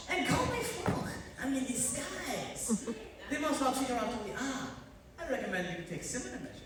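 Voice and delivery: hammed-up French chef accent; fake French accent. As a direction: lay the French accent on thick, like a cartoon chef. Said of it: "Why are you speaking in a German accent?".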